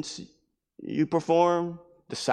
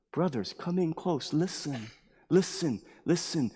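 acted teacher quietly calling followers close; hushed gathering; 5 dB quieter than usual. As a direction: softly draw the listeners in. Jesus gathers his disciples close.

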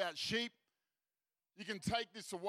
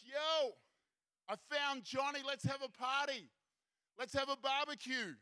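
high, triumphant call to the guys; celebrating shout; 4 semitones higher than usual. Acted. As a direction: announce good news to the whole crowd. Shepherd announces party to the guys.

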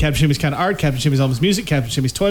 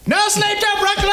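shouting. Pretend. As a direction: belt it like a chant. Joke rallying cry answer.

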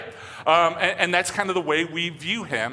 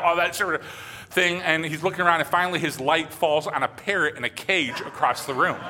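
ordinary delivery

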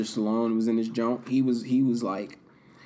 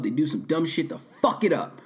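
ordinary talk

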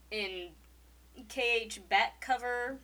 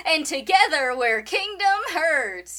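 loud, higher slogan shout; loud announcer; 6 semitones higher, 11 dB louder than usual. Pretend. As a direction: belt out the show slogan. Louder retake of slogan.

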